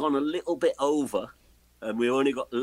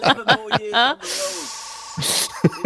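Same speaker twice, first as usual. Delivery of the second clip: mock defiance; 9 semitones higher, 6 dB louder, slower than usual. Real, not acted. Joking reply to the home's call.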